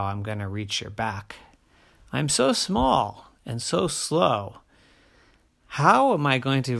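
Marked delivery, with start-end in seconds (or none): none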